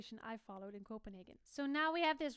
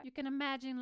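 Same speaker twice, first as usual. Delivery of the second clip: ordinary talk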